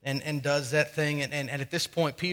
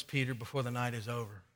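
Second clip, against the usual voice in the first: low, soft and close; quiet confiding warning; 4 semitones lower, 8 dB quieter than usual. Retold or imitated. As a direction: lower the voice as if pulling someone closer. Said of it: "pulls him a little closer and says".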